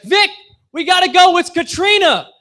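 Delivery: high, loud frantic mom; mom urgently calling out. Retold or imitated. Mom rushing her son out before the storm, high panicked yell. Mom yelling about hurricane.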